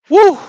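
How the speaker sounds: a loud joyful woo; overwhelmed happy whoop; excited whoop. Real, not acted. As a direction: let out a whoop of release. Overwhelmed by the divine, exclaims.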